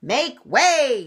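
high, loud command; yelling limousine. A narrator's reading. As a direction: yell to clear the road. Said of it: "yelled a limousine".

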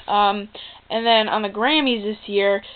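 usual speaking voice

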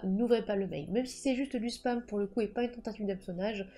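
ordinary talk, nothing marked

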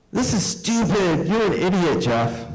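put-on bratty boy calling his guest an idiot; whiny bratty kid. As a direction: whine like a spoiled child. Friend whining at guest.

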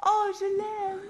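normal talking voice

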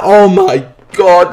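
ordinary talk

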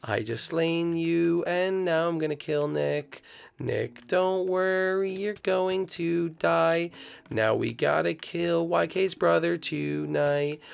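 high, slow villain croon; creepy lullaby voice. Pretend. Gamer taunting the caught friend in a slow creepy croon. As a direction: croon threats like a sinister lullaby.

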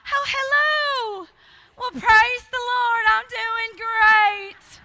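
high, sweet and drawn-out polite church lady. Retold or imitated. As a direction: put on an overly sweet churchy phone voice, high and slow. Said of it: "you put your church voice on".

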